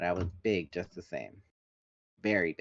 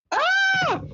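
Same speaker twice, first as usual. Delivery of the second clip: panicked scream; 9 dB louder than usual. A narrator's reading.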